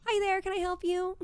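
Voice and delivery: bright, high service-desk greeting; sweet helpful voice for a customer. Shown as a demonstration. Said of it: "customer service voice".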